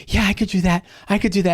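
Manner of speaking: lightly voiced bunny agreeing too fast; sly pet rabbit promising to help. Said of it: "And Thumper's like".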